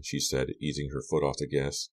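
ordinary delivery, nothing marked

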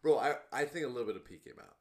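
words through laughter; laughing while talking. Real, not acted. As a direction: talk while still laughing. Laughing while retelling prank.

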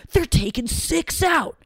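high, drawn-out protest; mock outrage. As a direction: act aggrieved about money taken. Mock protest about deductions.

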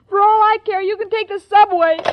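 loud, fed-up shout; angry outburst. Acted. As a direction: blast the caller away. Irma snaps at caller.

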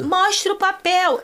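high, slow mock demand; demanding friend. Samira confronting the attendants, high slow demand.

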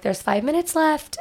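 high, slow sing-song warning; warning voice to child. Retold or imitated. Mom warning her daughter at the gym, high and slow. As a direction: give the daughter a gentle heads-up. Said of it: "I'm like".

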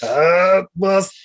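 a mind-controlled machine stating its orders; choking Hawk, the controlled robot speaks slowly; brainwashed robot. Retold or imitated. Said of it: "he's got his hands like".

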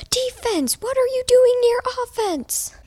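high-pitched mock coach's yell. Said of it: "the coach was yelling us".

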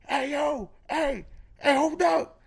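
high street holler in a rough voice; rough scrappy voice. Retold or imitated. Stranger hailing the storyteller from across the street, rough scrappy holler. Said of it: "rough, scrappy voice".

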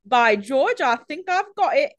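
high, hammed-up fake British; overdone mock British accent; terrible British accent. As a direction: parody an actor attempting British. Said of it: "some of the worst accent work he could find".

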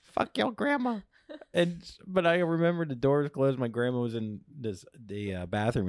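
normal talking voice

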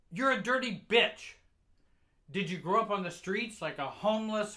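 high, very loud tirade building in force; furious scolding mother. Acted. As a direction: tear into the child at a shout. Enraged mother scolding her child.

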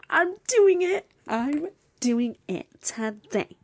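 high, loud, drawn-out excitement; excited declaration. Real, not acted. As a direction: draw it out, thrilled and determined. Excited declaration about the day.

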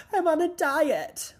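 high, loud, drawn-out mock announcement; over-the-top imitated confession, slow and shrieked; dramatic outburst. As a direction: blurt it out in a hammed-up wail. Acted dramatic confession in retold scene.

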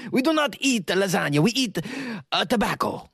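high, hammed-up French accent; mock French accent. Patrons correcting Jonesy that they are French, mock French accent. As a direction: put on an indignant cartoon French accent. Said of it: "we find out that they're French. They're like".

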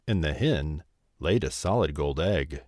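ordinary delivery, nothing marked

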